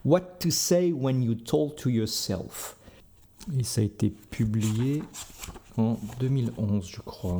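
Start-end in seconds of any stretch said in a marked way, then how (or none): 0.1-2.8 slow, self-mocking French-accented English title. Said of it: "attention avec mon super accent".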